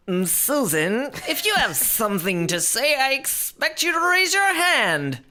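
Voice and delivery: high, stern teacher voice. Acted. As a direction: scold like a strict teacher.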